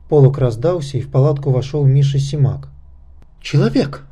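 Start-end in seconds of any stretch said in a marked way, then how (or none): 3.4-4.1 high-pitched startled outburst; involuntary exclamation. A narrator's reading. Nakhta sees Misha walk into the tent, startled outburst. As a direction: let the word slip out, pitch leaping up. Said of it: "вырвалось у Накхты".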